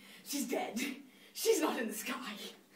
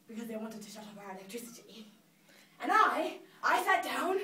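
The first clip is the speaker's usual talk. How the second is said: ordinary talk, nothing marked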